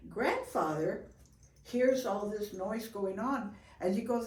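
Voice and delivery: ordinary talk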